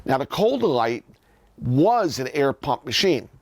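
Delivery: usual speaking voice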